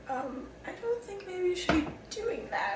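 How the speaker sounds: imitating a mother in a high, soft voice; hesitant concerned mom. Mom to daughter drinking for the pain, hesitantly concerned.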